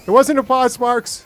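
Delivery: loud, high, insistent denial; loud protest. Real, not acted. Gamer loudly denies pausing to his chat. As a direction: protest loudly that it wasn't a pause. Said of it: "That, that wasn't a pause".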